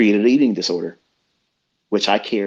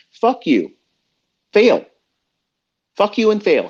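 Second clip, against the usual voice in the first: higher, louder angry snap-back; defiant outburst; 10 semitones higher, 3 dB louder than usual. Acted. Host answers complaining listeners, angry higher outburst. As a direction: spit it back at the haters.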